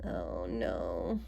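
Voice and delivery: low, soft, sunken "oh, no"; dismayed groan. Acted. Reader reacts to the rival's win, dismayed. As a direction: let the voice drop in dismay.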